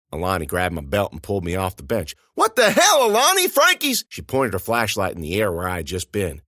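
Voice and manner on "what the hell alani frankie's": very high, loud protest; outraged shout. A narrator's reading. Narrator protesting at Alani, high and loud. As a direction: shout in disbelief.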